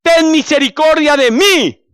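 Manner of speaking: loud, high-pitched cry for mercy; desperate blind beggar's shout; shouting plea. Acted. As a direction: call out to be heard over a crowd. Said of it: "comienza a gri-, a, a gritar".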